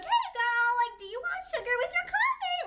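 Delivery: very high-pitched, over-the-top gushing greeting; annoyingly friendly server. Pretend. Pretending to work the drive-thru, high annoyingly chummy voice. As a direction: squeal it like an overeager drive-thru worker. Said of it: "'Cause I'm annoying".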